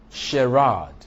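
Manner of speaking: "charade" punched loud on RADE; weak first syllable in "charade", strong second; stress on second syllable. Shown as a demonstration. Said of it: "does not take stress".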